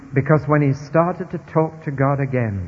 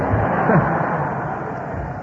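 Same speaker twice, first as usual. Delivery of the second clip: airy laugh; 3 semitones higher than usual. Real, not acted. Laughter after the punchline.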